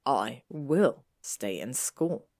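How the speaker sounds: slow, low, weighted "I will"; deliberate. A narrator's reading. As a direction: take your time, lean on "will". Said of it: "Draco took a deep breath".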